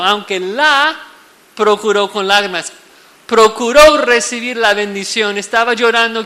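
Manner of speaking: más alto y más fuerte, a todo pulmón; predicación fervorosa. Acted. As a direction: predica con urgencia a la congregación. Predica con fuerza. (predicador exaltado, voz alzada)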